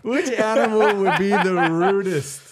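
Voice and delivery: laughing through the question, drawn out; cracking up while repeating the question. Real, not acted. Podcast host repeats silly question laughing. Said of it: "I didn't think you were gonna say that".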